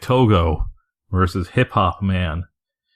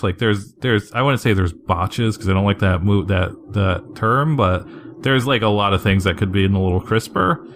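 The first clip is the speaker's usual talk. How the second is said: normal talking voice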